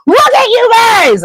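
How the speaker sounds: high, loud panicked shriek; alarmed squeal at the boys. Acted. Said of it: "Hermione shrieks".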